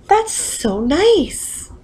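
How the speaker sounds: high, slow, sweet mother voice; delighted mother. A narrator's reading. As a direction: draw it out, sugary and high. Mother praising the cookie.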